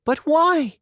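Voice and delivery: put-on a girl crying out in surprise; surprised. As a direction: demand the reason in shock. Anne shocked.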